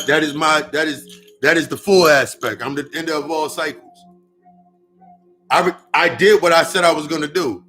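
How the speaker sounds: ordinary talk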